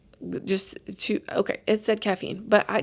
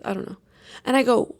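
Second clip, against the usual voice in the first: ordinary talk, nothing marked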